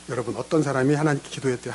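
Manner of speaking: normal talking voice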